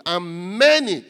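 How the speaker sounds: "many" drawn out slowly and loud; stress on many. Shown as a demonstration. Preacher reading scripture to congregation, heavy stress on "many". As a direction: underline "many" with a loud, slow punch. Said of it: "I want you to underline that word".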